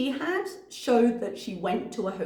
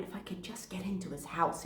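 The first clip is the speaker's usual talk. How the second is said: whisper; 3 semitones lower, 11 dB quieter, faster than usual. Retold or imitated. Voicing her hidden wish.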